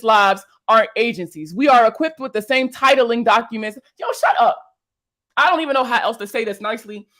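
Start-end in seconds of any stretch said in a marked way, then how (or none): 4.0-4.6 much higher, sharp exasperated yell of shut; fed up, cut the commenter off; exasperated outburst. Real, not acted. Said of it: "I'm getting so frustrated".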